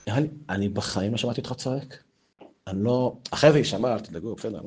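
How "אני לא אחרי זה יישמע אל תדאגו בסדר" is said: normal talking voice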